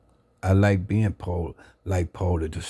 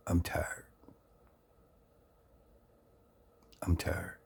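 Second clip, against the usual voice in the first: tired resignation; 2 semitones lower, 9 dB quieter, slower than usual. Retold or imitated. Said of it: "Something that Tookie said".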